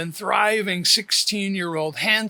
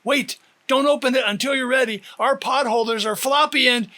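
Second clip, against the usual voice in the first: fast, higher hurried warning; 6 semitones higher, 4 dB louder, faster than usual. A narrator's reading. Uncle warns the girls about the hot tray, fast and higher. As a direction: rush the warning out.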